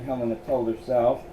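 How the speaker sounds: ordinary delivery, nothing marked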